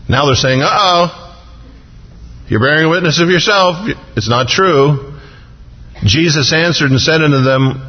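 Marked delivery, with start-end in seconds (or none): 0.6-5.1 higher, smug gotcha tone; mock gotcha. Preacher mimicking Pharisees catching Jesus out, smug and higher. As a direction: catch him out, pleased with yourself.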